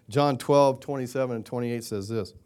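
usual speaking voice

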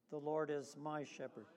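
"my" emphasized in a softly spoken line; stress on MY. Shown as a demonstration. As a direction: softly lean on the word "my". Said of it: "emphasizing the word my".

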